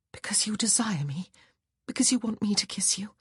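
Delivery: hushed, disbelieving whisper. Acted. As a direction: breathe the questions. Wife whispers disbelieving questions.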